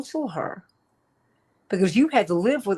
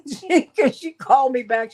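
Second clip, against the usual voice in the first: laughing through the words, a bit higher; 7 semitones higher, 4 dB louder than usual. Real, not acted. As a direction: chuckle as the story lands. Laughing at her own story.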